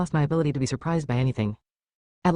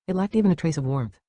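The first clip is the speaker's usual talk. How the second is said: ordinary delivery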